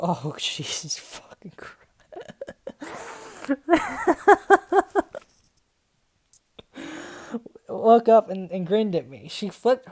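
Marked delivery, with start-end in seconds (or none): 0.0-1.8 breathy whispered curse under a laugh; exasperated whisper. Real, not acted. Reading awful fanfic, she whispers a curse in disbelief. 2.1-5.2 a long high squeal of laughter; can't hold it in, let the laugh go high; helpless high laugh. Real, not acted. Cracking up at the fanfic, a high helpless laugh.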